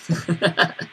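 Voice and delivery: quick high chuckle; short laugh. Real, not acted. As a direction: laugh lightly at yourself. Laugh after joke.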